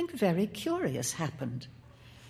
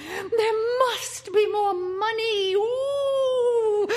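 very high, drawn-out trilling shriek; screaming ecstasy; 4 dB louder, much slower than usual. A narrator's reading. The house's voices shriek for money, screaming in ecstasy. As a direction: wail it out slowly, far above your own voice. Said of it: "simply trilled and screamed in a sort of ecstasy".

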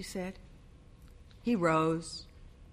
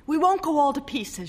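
insistent bride; 9 semitones higher, 3 dB louder than usual. A narrator's reading.

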